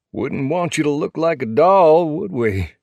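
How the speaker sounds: high, mocking tease; teasing biker. Princess teases Half Pint in story.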